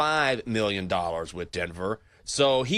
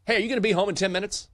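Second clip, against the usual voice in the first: higher, fast, breezy mock question; imitating Kevin; 9 semitones higher, much faster than usual. Handyman Kevin asking at the last minute, fast breezy mock question. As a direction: rattle it off like it's no big deal.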